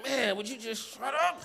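high, slow exasperated plea; mock annoyed. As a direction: roll your eyes and drag it out.

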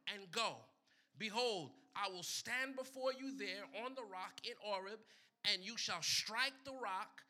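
loud, ringing scripture reading; preaching at full force. Acted. Preacher to congregation, booming scripture read. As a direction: proclaim the Lord's words to the crowd.

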